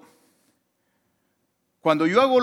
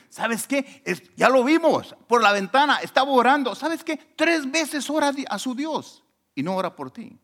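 higher, eager snitching voice; tattling accusers; 12 semitones higher, 3 dB louder than usual. Retold or imitated. Accusers reporting Daniel's praying to the king, eager and higher. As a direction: play the snitches running to tell the king.

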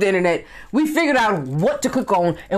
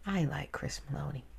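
much softer, dreamy murmur; soft aside; 5 semitones lower, 16 dB quieter than usual. Real, not acted. Vlogger admiring an actor, soft murmur. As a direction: say it under your breath.

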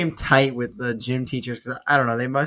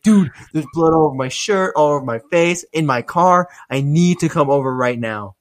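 louder, higher imitation of a panicked friend; freaking out; 3 semitones higher, 6 dB louder than usual. Friend begging for help over the phone, imitated freak-out. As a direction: act out his frantic, insistent plea. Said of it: "he's, like, freaking out".

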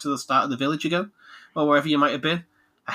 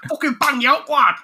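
high, loud Mancunian threat; Manchester accent; 13 semitones higher, 6 dB louder than usual. Acted. Game hero to everyone he fights, shouted Manchester accent. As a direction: yell it like a Manchester scrapper. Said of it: "to everyone he fights".

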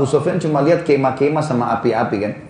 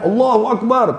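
takbir call; 7 semitones higher, slower than usual. Retold or imitated. Army shouts takbir passing by.

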